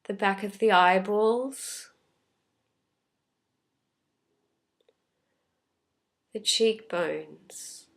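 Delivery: unhurried, calm meditation voice with long pauses; guided meditation. Real, not acted. Teacher leading listeners through a body scan, calm guided meditation. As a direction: gently guide them inward, leaving space after each phrase.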